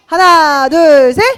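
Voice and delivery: high, loud rallying count. Real, not acted. Pastor cueing children to shout the answer, loud high count-in. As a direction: count the group in to shout together.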